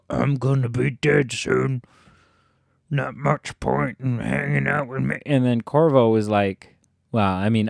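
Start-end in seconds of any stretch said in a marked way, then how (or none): 0.1-5.2 lightly imitated game character voice; Samuel. Player imitating Samuel to explain his death, put-on voice. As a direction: put on another man's voice, mildly. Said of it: "Samuel was like".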